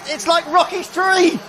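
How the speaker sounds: high, amazed comparison; giddy disbelief, pitch way up; excited. Real, not acted. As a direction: gush at the chaos on track. Riders crash at the finish.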